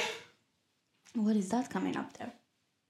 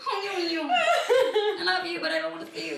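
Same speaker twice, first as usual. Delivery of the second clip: laughing; 6 semitones higher than usual. Real, not acted. Laughing teasing confession.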